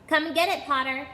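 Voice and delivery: loud, high mocking call across the air; taunting call. A narrator's reading. Bully taunting Harry from the air, loud mocking call. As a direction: taunt him from above. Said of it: "he called out".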